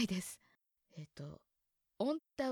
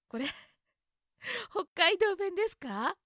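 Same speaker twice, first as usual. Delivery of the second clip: high, louder laughing disbelief; a real laugh of surprise at the entry; 8 semitones higher, 5 dB louder than usual. Real, not acted. Reading the dictionary, amused to find it listed as dialect.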